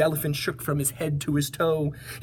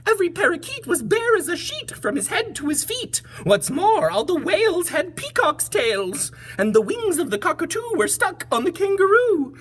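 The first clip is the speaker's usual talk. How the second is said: very high, louder fretting birds; high bird voices; 14 semitones higher, 3 dB louder than usual. Acted. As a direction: complain as ruffled little birds. Birds speaking.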